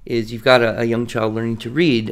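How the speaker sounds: ordinary talk, nothing marked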